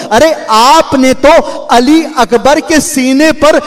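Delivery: rising mournful preacher's chant, drawn out; preacher moving mourners to weep; lamenting majlis recitation. Acted. Preacher reciting masaib to the mourners, in a rising mournful chant.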